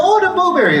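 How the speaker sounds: put-on high squeaky toddler cry of dismay; little boy whine. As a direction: play the three-year-old wailing about his blueberry. Three-year-old son voiced in story.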